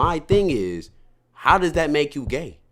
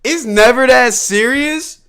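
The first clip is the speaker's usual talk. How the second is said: laughing; 7 semitones higher, 9 dB louder than usual. Real, not acted. Still laughing after the story.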